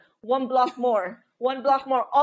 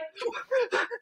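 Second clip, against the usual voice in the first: laugh; 11 semitones higher, 7 dB quieter than usual. Real, not acted. Laughs while recalling her smoking.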